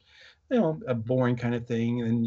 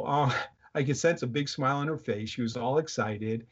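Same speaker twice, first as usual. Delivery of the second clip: normal talking voice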